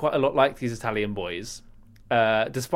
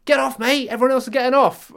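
stranger urging; 11 semitones higher, 5 dB louder than usual. Retold or imitated. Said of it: "this guy tugged me".

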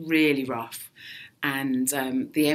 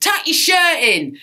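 loud, high, drawn-out heckle from the crowd; mock heckle, shouted slowly; heckler's shout; 11 semitones higher, 7 dB louder, slower than usual. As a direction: imitate a bloke shouting at the stage. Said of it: "some bloke shouted".

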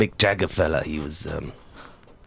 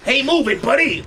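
road rage yelling; 4 dB louder than usual. Acted. Pedestrian yelling at the kidney.

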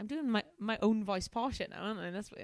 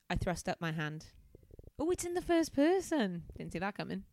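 usual speaking voice

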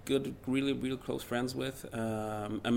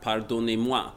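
slow, halting tourist French; deliberately bad French phrasebook voice; slower than usual. Shown as a demonstration. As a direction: mangle the French on purpose. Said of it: "in really, really bad French".

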